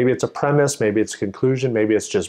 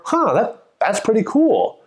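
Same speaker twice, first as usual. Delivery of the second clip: much higher, pleasantly surprised huh; impressed surprise; 9 semitones higher than usual. Shown as a demonstration. Listener reacting to a study result, pleasantly surprised. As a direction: react as if hearing a neat fact. Acted listener hearing a fact.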